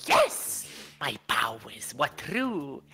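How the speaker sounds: gloating triumph. Acted. Character gloats over guess.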